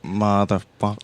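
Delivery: "Motherfucker" with a dropped R, imitated; Alan Rickman. Co-host echoing the villain's line, imitated pronunciation. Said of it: "just the way he says".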